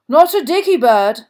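loud, high and untroubled; comfortable. A narrator's reading. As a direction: boom it out without a care. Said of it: "said Bagman comfortably".

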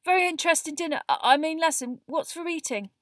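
very high, loud, muddled babble; unnaturally high gabble. A narrator's reading. Neville covers his distress, gabbling unnaturally high. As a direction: stumble over your words in a high voice. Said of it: "the same unnaturally high voice".

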